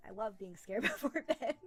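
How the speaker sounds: tickled by your own bedtime habit; amused. Real, not acted. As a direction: laugh through the joke. Jokes about being scared at bedtime.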